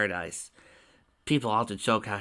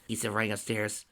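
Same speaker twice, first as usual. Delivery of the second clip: plain reading voice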